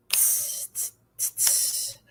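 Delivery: soft tss hi-hat sound, unvoiced; cymbal hiss. Shown as a demonstration. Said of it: "Feather the rim of a double cymbal".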